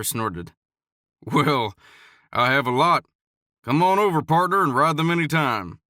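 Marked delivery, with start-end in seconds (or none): 1.2-5.8 friendly cowboy. A narrator's reading. Cowboy inviting the boy.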